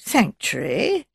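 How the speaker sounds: slow, rising, puzzled question. A narrator's reading. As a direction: query the unfamiliar word, drawn out. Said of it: "said in a puzzled voice".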